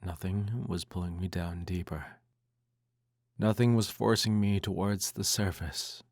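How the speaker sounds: ordinary delivery, nothing marked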